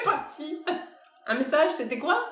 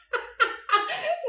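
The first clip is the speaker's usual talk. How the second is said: high falling peal of laughter. Real, not acted. Medium laughing at herself, high peal of laughter. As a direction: burst out laughing.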